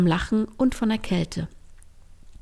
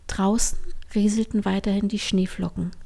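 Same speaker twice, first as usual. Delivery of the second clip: ordinary delivery, nothing marked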